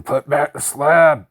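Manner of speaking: loud slow panicked scream. A narrator's reading. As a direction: scream the order in terror. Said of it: "he just kept screaming".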